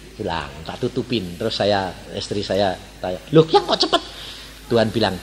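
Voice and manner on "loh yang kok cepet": surprised wife. Retold or imitated.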